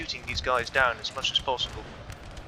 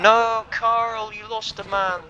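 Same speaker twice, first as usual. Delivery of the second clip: dismayed cry; 9 semitones higher, 5 dB louder, much slower than usual. Real, not acted. General attacked in battle.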